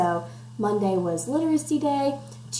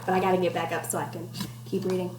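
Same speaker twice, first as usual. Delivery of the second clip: ordinary delivery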